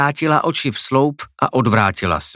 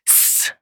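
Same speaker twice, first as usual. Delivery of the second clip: short soft hiss; a quiet sibilant sizzle; imitated hiss; 8 dB quieter than usual. A narrator's reading. Blade passing through the monster, soft hissing sound.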